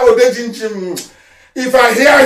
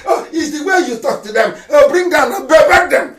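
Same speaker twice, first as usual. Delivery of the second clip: usual speaking voice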